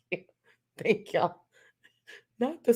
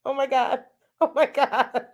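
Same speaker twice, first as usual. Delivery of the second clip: high-pitched laughing oh my God; barely getting it out through giggles; 8 semitones higher than usual. Real, not acted. Reacting to chat screaming garlic bread, laughing.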